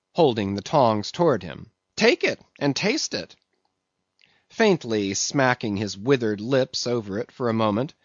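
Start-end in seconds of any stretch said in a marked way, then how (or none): 2.0-3.4 high-pitched insistent command; insistent order. A narrator's reading. Mate holding out the steak, insistent. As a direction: press it on him, pitched up. Stubb orders cook to taste.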